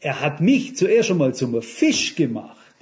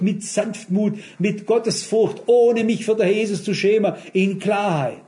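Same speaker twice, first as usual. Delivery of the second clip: impassioned preaching; 5 semitones higher than usual. Real, not acted. Preaching climax to congregation.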